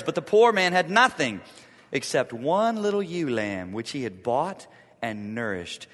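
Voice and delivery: steady reading of the passage aloud; scripture reading. Acted. Preacher reading scripture to his church, measured. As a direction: read the Bible text to the congregation.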